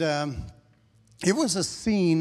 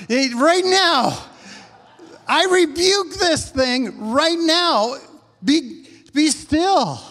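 loud, high, drawn-out command; booming preacher's rebuke, pitched high; 8 semitones higher, 5 dB louder, slower than usual. Acted. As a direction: order the storm down slowly and forcefully. Acts Jesus rebuking the storm.

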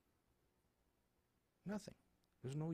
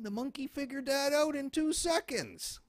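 triumphant; 13 semitones higher, 11 dB louder than usual. Real, not acted. Mocking triumph.

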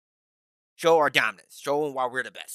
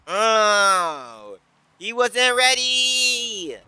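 long drawn-out, high-pitched holler; gleeful whoop stretched slow; excited holler; 6 semitones higher, 3 dB louder, much slower than usual. Real, not acted. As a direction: crow it out, stretching every vowel. Gamer hollers after catching opponent out.

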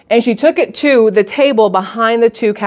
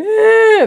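mock child's wail, higher; toddler wail; 12 semitones higher than usual. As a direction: imitate the wail again, a bit smaller. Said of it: "'Cause you know how kids do".

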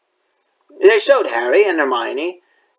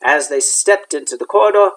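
ordinary delivery

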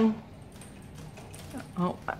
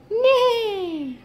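horse neigh; 12 semitones higher than usual. Acted. Horse sound for toddler.

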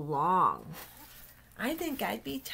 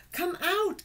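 God calling; 11 semitones higher, 4 dB louder than usual. Acted. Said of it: "God said to Noah".

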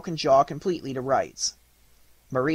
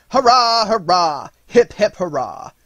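loud, high cheering; jubilant cry; 6 semitones higher, 10 dB louder, slower than usual. A narrator's reading. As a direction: cheer like a king beside himself with joy. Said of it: "crying again and again".

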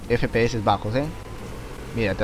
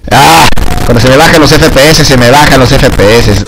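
frustrated yell; 17 dB louder than usual. Real, not acted. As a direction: yell at the lagging game. Se queja del bajón de FPS. (queja gritada, muy fuerte)

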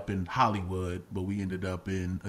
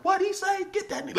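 high-pitched, indignant retort; mock outraged reaction. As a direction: play at flaring up at a heckler. Acts out his near reaction to heckler.

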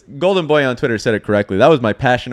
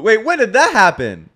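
normal talking voice

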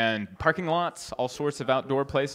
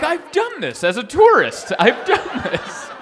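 laughing disbelief; 9 semitones higher, 5 dB louder than usual. Retold or imitated. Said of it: "And I'm like".

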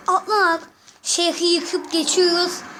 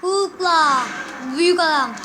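usual speaking voice

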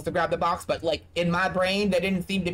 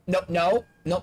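ordinary talk

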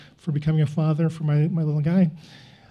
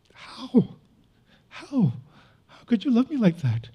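tearful; 4 semitones higher, 3 dB quieter than usual. Retold or imitated. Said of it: "And I'm just weeping".

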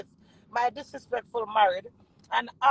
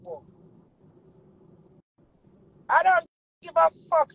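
ordinary delivery

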